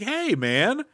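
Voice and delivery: high, indignant mock protest. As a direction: squeak out your offended complaint.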